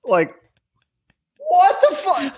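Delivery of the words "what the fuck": high, squeaky disbelieving cry; shocked disbelief. Real, not acted. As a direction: spit it out in disbelief. Shocked outburst.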